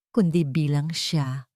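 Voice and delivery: ordinary talk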